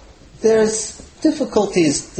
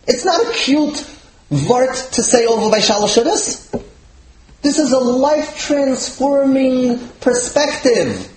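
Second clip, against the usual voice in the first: raised pitch, drawn-out key words; emphatic insistence; 6 semitones higher than usual. Acted. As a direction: insist on it with slow weight. Rabbi's emphatic rejection, acted.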